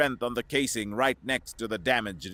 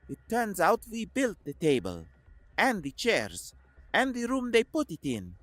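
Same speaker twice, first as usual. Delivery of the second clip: Koslov's voice; 5 semitones higher than usual. A narrator's reading.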